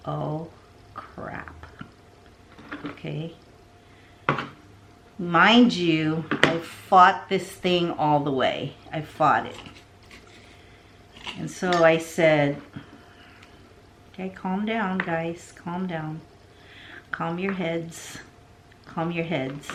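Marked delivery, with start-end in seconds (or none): 0.1-3.3 whispered dismay. Retold or imitated. Quoting her own dismay. 14.1-19.7 self-calming inner voice. Retold or imitated. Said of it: "This is two guys in my head".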